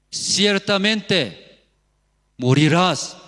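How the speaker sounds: loud, drawn-out shouted warning; shouting. Acted. Preacher voicing God's warning to Adam, shouting. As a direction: shout each word out slowly. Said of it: "¿Por qué estoy gritando?".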